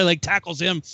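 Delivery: normal talking voice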